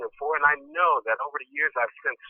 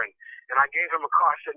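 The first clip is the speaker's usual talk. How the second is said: usual speaking voice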